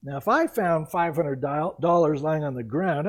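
ordinary talk

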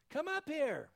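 loud, high summons upward; commanding call. Acted. Preacher voicing Jesus calling believers up, loud call. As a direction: call out "Come up here" as a mighty summons.

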